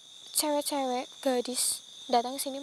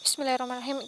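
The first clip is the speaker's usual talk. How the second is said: usual speaking voice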